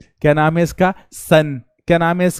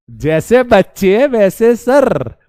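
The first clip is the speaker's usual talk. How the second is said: very slow, sing-song punchline; drawn-out mock saying; 2 semitones higher, much slower than usual. Teacher jokes to students he's one of them, very slow and drawn out. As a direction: stretch it like a filmi dialogue. Mock proverb line, sung out.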